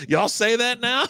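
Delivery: high-pitched incredulous question; retold astonishment. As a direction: imitate a baffled time traveler. Retold time traveler's astonishment.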